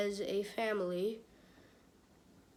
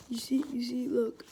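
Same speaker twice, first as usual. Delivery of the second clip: ordinary delivery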